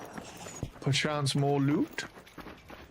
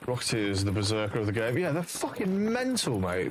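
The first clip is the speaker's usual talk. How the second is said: ordinary talk, nothing marked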